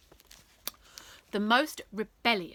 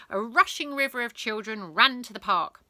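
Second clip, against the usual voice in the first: usual speaking voice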